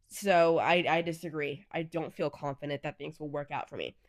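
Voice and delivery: normal talking voice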